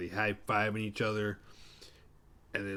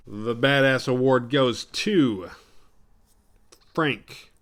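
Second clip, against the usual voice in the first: loud, drawn-out award presentation; award announcer; 3 semitones higher, 10 dB louder, much slower than usual. Pretend. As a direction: announce the winner, stretching "to" before the name.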